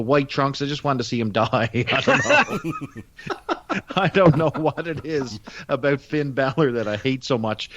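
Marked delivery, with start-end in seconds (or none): 1.7-6.7 high-pitched giggling through the words; can't stop laughing while saying it; slow, giggly real laughter. Real, not acted. Laughing at his own grudge.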